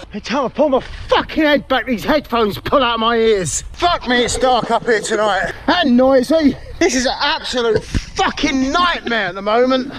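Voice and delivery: ordinary talk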